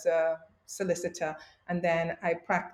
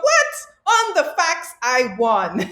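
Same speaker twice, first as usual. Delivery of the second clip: a sharp, much higher "What?" then outraged insistence; indignant disbelief; 8 semitones higher, 8 dB louder, slower than usual. Retold or imitated. Retelling the lost mock trial to the host, indignant disbelief. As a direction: retell the injustice with indignant shock. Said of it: "And I thought".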